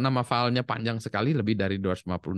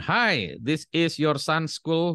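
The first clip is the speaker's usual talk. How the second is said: higher, slow, polite school caller; school on the phone; 6 semitones higher, much slower than usual. Retold or imitated. School phones a mother, a lightly voiced polite caller. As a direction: read it like the school calling a parent. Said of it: "Jadi ini ada di telepon".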